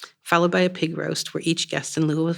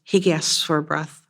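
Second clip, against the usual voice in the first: usual speaking voice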